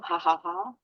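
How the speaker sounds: light, deliberate ha-ha giggle; demonstrated giggle. Shown as a demonstration. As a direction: laugh out "ha ha ha" to coax a giggle. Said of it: "get a little giggle".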